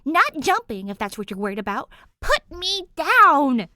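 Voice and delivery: high, loud indignant yell; protesting. A narrator's reading. Danny yelling at her captor to be put down. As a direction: squawk it, dangling and annoyed.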